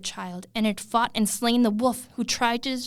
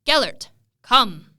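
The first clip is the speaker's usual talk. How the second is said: slow, loud call across the yard; calling the dog; 3 semitones higher, 10 dB louder than usual. Acted. Prince calling his missing hound before the hunt, loud slow call. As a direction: bellow the dog's name, drawn out. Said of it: "called Gellert by name".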